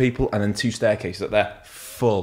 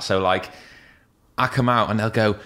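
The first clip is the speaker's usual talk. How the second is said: normal talking voice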